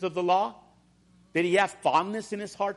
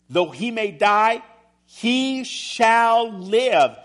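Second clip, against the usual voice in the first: preaching at full force; 3 semitones higher, 6 dB louder than usual. Acted. Preacher voicing Jesus' proclamation.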